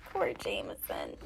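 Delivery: soft, low whispered sympathy; pitying whisper. A narrator's reading. Storyteller feeling sorry for the boy, whispering. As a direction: breathe it out with pity.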